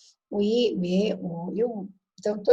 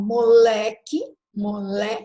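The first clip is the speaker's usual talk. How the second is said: moleque slowed and louder on le; stress on le; 4 dB louder, much slower than usual. Shown as a demonstration. Teacher shows class the tonic syllable of moleque, stressed. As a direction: lean hard and long on the le of moleque. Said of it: "Lé, ele é mais forte".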